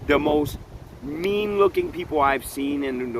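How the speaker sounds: ordinary talk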